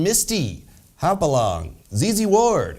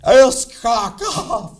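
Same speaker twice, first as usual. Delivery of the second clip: drawn-out, louder mock outburst; mock yelled-out noise; 7 semitones higher, 6 dB louder, much slower than usual. Anchor naming a gala as a silly noise, mock yell. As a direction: yell it out slowly like an absurd noise. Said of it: "that's the same noise Mike Pence yells out".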